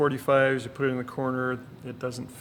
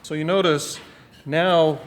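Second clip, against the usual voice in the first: raised voice over machine noise; shouting over table saw; 3 semitones higher, 7 dB louder, slower than usual. Real, not acted. Talking just after running saw.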